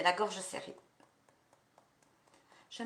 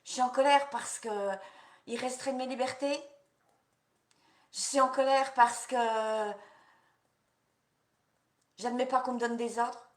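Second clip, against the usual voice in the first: raised, indignant declaration; demonstrated anger; 3 semitones higher, 4 dB louder than usual. Shown as a demonstration. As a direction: voice the anger out loud. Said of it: "dites pourquoi vous êtes en colère".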